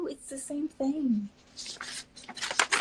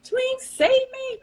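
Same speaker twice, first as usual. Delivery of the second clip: high, loud, slow plea; calling for help; 13 semitones higher, 10 dB louder, much slower than usual. Real, not acted. Host pleading with her helper to find the poem, loud drawn-out call. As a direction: call out a long drawn plea across the room.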